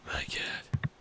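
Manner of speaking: ordinary talk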